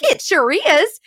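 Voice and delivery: high-pitched imitated little old lady. Mama answering the phone call, as an imitated little old lady. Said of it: "little old lady".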